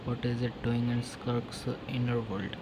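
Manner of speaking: normal talking voice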